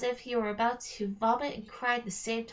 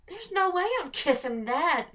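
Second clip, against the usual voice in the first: higher, revolted protest; disgusted boy refusing; 6 semitones higher, 4 dB louder than usual. Acted. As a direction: recoil from the idea in a boy's voice. Connor refuses to kiss troll.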